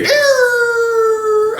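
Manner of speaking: long, very high-pitched wail; high sustained sound effect; imitated siren. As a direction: hold a high squeal like an alarm. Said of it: "you hear".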